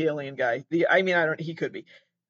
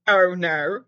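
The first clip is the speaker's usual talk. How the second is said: louder mock Australian accent; 6 dB louder than usual. Australian contestant hearing from the second caller, mock Australian accent. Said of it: "because she's still Australian".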